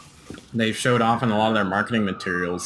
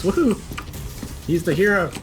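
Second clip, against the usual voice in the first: excited; 8 semitones higher than usual. Real, not acted. Cheering Captain Price's arrival.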